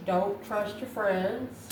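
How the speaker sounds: ordinary delivery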